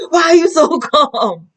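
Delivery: high, laughing disbelief at his calm; laughing squeal. Retold or imitated. Laughing at her calm husband, high laughing question. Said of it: "I'm like".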